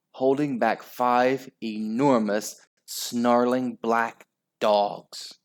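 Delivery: ordinary delivery, nothing marked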